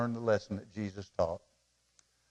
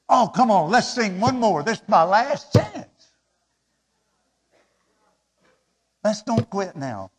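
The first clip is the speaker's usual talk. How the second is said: pleading loudly, higher, slowing at the end; 8 semitones higher, 12 dB louder than usual. Acted. Worshipper begging the pastor for one more song, loud pleading. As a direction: beg to sing one more, loud and high. Congregant begging to keep singing.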